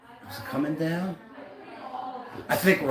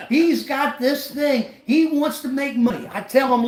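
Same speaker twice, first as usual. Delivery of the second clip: ordinary talk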